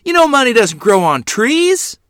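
high, loud scolding; angry yelling. A narrator's reading. Mother scolding child over lost money, yelling. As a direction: keep yelling at the careless child. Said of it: "she yelled".

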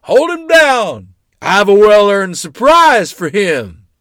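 slow, high-pitched booming holler; big booming shout. A narrator's reading. Great-uncle promising a surprise from high above, booming. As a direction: boom it down from far above. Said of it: "I heard a big booming voice".

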